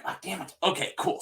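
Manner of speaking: normal talking voice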